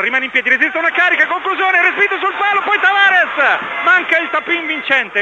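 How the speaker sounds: rising, quickening live call; excited play-by-play. Acted. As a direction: call the scramble in front of goal. Chance builds live.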